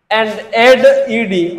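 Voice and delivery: And add ईडी drawn out very slowly; slow dictation. Shown as a demonstration. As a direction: read the rule word by word to the class. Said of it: "teacher dictating rule".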